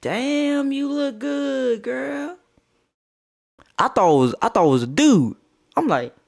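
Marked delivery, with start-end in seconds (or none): 0.0-2.4 high, drawn-out pickup line; no-filter girl. Retold or imitated. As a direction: play her as the bold girl, pitched up. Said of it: "She goes".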